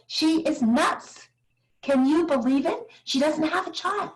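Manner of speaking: high, incredulous gossip voice; mock the friends calling her crazy; gossiping girlfriend. Girlfriends gossiping on the phone about her, incredulous and high. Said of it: "my girlfriends are all calling each other saying".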